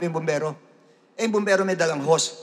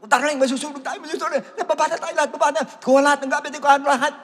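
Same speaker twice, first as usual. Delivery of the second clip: high-pitched mock panic; wife in a house fire urging her husband down, panicky; panicked wife; 12 semitones higher, faster than usual. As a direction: fuss in a hurry over the fire. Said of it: "medyo nataranta".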